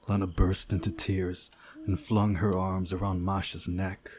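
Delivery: usual speaking voice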